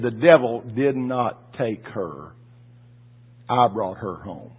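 low, very slow, solemn voice; slow solemn reassurance. Retold or imitated. God telling the widower she went home, slow and low. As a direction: lay each word down slowly as God.

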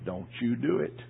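low, hushed warning; a hushed spirit warning; soft warning. Retold or imitated. Wife's spirit warning him from the corner, hushed.

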